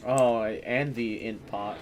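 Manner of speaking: ordinary delivery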